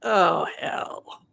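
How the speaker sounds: breathy whispered "Oh, hell." with a falling "Oh"; dismayed whisper. Retold or imitated. Friends try the vision and whisper "Oh, hell." in dread. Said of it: "My friends are like".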